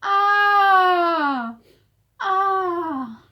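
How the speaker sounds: high, loud admiring ah; admiring sigh. Shown as a demonstration. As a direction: sigh in awe with the crowd. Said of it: "Say it with me".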